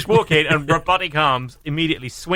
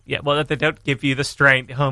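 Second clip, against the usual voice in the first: normal talking voice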